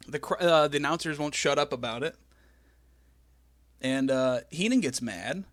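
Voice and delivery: ordinary talk, nothing marked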